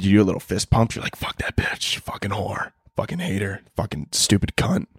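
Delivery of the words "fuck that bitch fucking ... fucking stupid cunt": muttered curses. Retold or imitated. Cursing aunt under breath.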